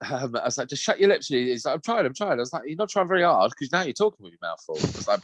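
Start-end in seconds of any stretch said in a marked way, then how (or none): 0.7-1.3 high-pitched parental impatience; retold scolding, pitched up; exasperated telling-off. Dad correcting his son's noisy eating, exasperated. 1.8-2.3 high-pitched imitation of a child; protesting child. Son answering dad's telling-off, protesting. 2.7-4.8 higher-pitched retold scolding; exasperated dad. Dad scolding son for talking while eating, exasperated.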